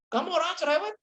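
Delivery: husband complaining. Acted. Husband nagging wife, acted quote.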